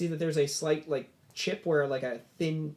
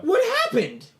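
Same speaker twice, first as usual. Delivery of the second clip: high surprised NPC voice; 9 dB louder than usual. Acted. GM voices NPC seeing blade.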